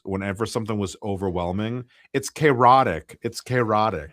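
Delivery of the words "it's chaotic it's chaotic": slow, louder kid imitation; lightly voiced child; child's phrase. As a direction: echo it like an overwhelmed little boy. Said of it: "Logan would use".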